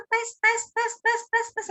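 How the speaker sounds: high, steady run of drips, tapering in volume; staccato water-drop sound, high and regular; dripping water imitation. Shown as a demonstration. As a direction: repeat each drop crisply, pitched well up. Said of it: "Tetes air".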